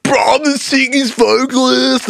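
put-on high, drawn-out drunken boast; drunk bragging. Singer drunk in the studio, boasting, hammed-up drunken yowl. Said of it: "I was drunk as fuck".

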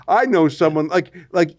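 ordinary delivery

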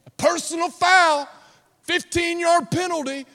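high, loud referee's penalty call. Acted. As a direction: call the foul out to the whole field. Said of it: "waiting to blow his whistle".

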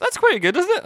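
high, loud mock Scottish voice; mock Scottish accent. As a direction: camp up the friend's fake Scottish accent. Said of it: "And he's like".

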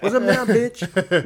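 slow, high, loud gloat; mock gloating taunt. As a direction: rub it in like the winner. Wife taunting husband, mocked.

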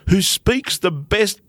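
normal talking voice

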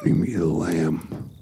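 low and slow; slow, dropped voice; dropping voice deep. Pretend. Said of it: "Ask me again in a deeper voice".

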